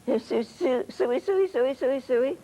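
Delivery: hog call. Retold or imitated. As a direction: holler for the pigs across a field. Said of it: "And a hog call".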